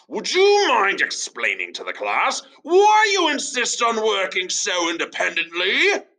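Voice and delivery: high, shouting angry teacher; fuming teacher shout. Acted. Teacher Douglas shouting at Tom before the class. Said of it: "fumed Teacher Douglas".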